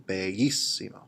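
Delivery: bellissimo with the weight on "lis"; stress on the middle syllable. Shown as a demonstration. Spanish teacher modelling the word for students, middle syllable stressed. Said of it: "the emphasis and accent is on that middle syllable".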